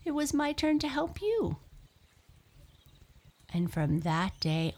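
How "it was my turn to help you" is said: high, fast little mouse voice; squeak. A narrator's reading. Mouse replying to the thankful lion, squeaking. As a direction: squeak it quickly as a tiny mouse. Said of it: "he squeaked".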